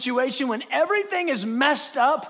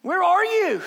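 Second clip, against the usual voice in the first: raised, pleading cry; desperate prayer; 8 semitones higher, 5 dB louder than usual. Acted. Believer crying to God after the night passes, pleading. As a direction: call out to an absent God.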